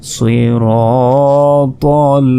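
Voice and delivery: সিরাতাল drawn out as heavy 'sui-roo-tol'; সিরাতাল with thick consonants, long held vowels; correct tajweed demo. Shown as a demonstration. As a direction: chant সিরাতাল slowly with emphatic s and t. Teacher models the correct word.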